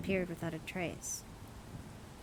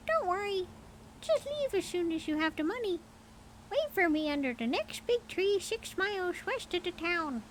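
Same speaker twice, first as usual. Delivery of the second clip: high bird voice; 11 semitones higher than usual. A narrator's reading. Mynah coaxing its owner, high bird voice. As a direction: chirp it out like a talking bird. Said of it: "replied the man".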